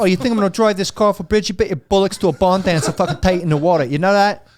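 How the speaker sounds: camp it up as a London bloke; hammed-up Cockney. Friends take turns riffing Cockney Bond, hammed-up Cockney accent. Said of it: "Cockney James Bond".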